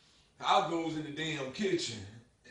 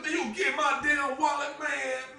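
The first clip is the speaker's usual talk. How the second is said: high, louder demand; indignant; 10 semitones higher, 4 dB louder than usual. Retold or imitated. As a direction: insist on the wallet, rattled. Demanding wallet back.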